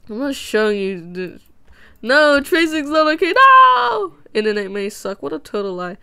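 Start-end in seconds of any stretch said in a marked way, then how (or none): 2.0-4.1 loud high mock outrage; shouting. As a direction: yell it in fake outrage. Mock outrage about tracing.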